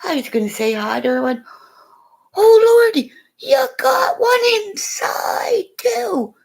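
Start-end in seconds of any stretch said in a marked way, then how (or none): 2.4-6.4 high, loud delighted gushing; excited gushing. Retold or imitated. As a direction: gush over the baby like a thrilled grandma. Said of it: "I said that just like that".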